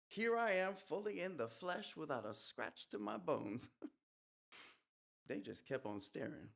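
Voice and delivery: usual speaking voice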